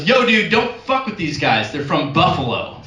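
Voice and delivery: second guard warns his buddy off; warning security guard. Retold or imitated. Guard warns colleague about Buffalo guys. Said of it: "Other security guard goes".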